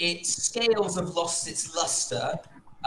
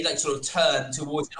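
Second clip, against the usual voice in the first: usual speaking voice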